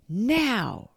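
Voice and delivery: loud, high command; sudden high, loud cry; urgent shout. A narrator's reading. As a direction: shout the signal to pounce. Child gives signal to pounce.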